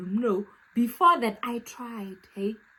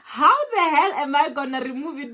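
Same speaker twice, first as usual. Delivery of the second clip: panicky wail; 5 semitones higher, 5 dB louder than usual. Retold or imitated. Panic over the trapped rat.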